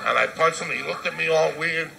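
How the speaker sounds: normal talking voice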